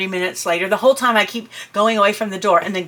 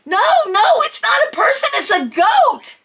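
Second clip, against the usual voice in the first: frantic; 13 semitones higher than usual. Retold or imitated. Insisting to husband it's a goat, frantic. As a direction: correct him in a panic. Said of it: "I'm like".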